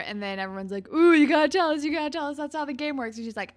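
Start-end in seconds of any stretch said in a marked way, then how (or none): 0.9-3.2 high, loud opening "ooh"; mock goading. Friends pressing Hazel to answer in truth or dare, mock goading. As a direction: imitate a whole group egging someone on. Quoting group egging Hazel on.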